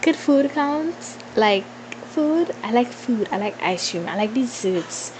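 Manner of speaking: ordinary delivery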